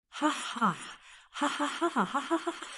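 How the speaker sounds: high, soft run of laughter; acted laugh that keeps on bubbling out; helpless laughter. A narrator's reading. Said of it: "couldn't help but laugh".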